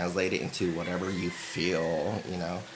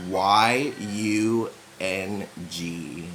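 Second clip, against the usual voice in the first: spelled out letter by letter; 2 semitones lower, much slower than usual. Shown as a demonstration. Explaining the spelling to viewers, slow letter-by-letter spelling. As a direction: slowly spell Y U N G, one letter at a time. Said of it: "lowercase, all".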